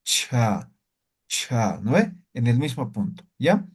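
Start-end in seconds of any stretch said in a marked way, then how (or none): 0.0-1.8 "cha" with a strong puff of air after the ch; aspirated cha. Shown as a demonstration. Teacher shows students the aspirated cha. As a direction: breathe out hard on "cha". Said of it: "aspirada, ¿sí?".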